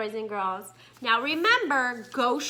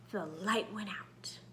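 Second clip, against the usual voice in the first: whispered, much softer, lower; suspense whisper; 5 semitones lower, 14 dB quieter than usual. Acted. Teacher building suspense for the children, whispering. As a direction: drop to a hush for suspense.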